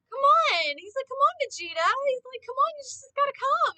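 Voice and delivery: a hammed-up begging Goku; Goku whining. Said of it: "And he's like".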